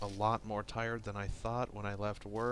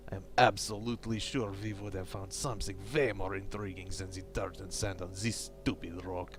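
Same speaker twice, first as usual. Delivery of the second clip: a lightly done fake Eastern European accent reading the diary; bad Russian accent. Gamer reading crew member Pavel's diary entries aloud, in a light fake Russian accent. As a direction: read the log in a hammy Russian accent, only a hint of it. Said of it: "my bad, fake, slightly racist Russian slash Eastern European accent".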